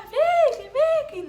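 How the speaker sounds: loud, high call across the building; shouting a name. Retold or imitated. Calling Vicky from the street outside the building, loud high shout. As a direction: yell her name to be heard far off. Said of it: "Aí eu gritava".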